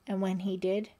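plain reading voice

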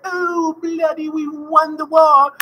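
slow, hammed-up British King George drawl; mock British king. Teacher voicing King George gloating over the victory, in a mock British accent. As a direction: play the pompous king in a cartoon British accent. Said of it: "He's like".

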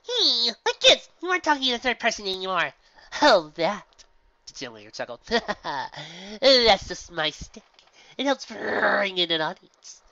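loud, slow showman voice; showy gym leader. Acted. Gym leader explains her stage act to Pinky, loud and showy. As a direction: ham it up for the crowd.

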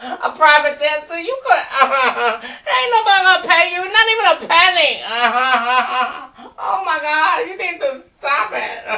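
mock through helpless laughter; cracking up, barely getting words out; laughing mockery. Cracking up at the singer.

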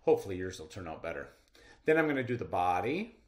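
ordinary talk, nothing marked

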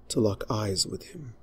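ordinary delivery

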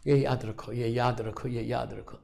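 repeated reminder dying to a hush; fading admonition. Acted. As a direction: let the warning fade away.

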